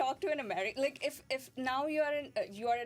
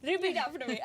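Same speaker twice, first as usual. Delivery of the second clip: teacherly command; 4 semitones higher, 5 dB louder, much faster than usual. Retold or imitated. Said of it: "And I would be like".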